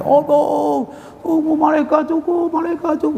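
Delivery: high, strained cry of terror from the grave; mock grave scream. Preacher voicing a tormented dead man crying out, high mock wail.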